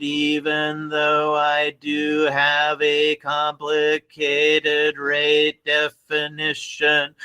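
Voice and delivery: very slow, evenly spaced robot speech; slow robotic voice. Shown as a demonstration. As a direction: drag every word out like a machine. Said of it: "this I think is how a D A F machine would sound".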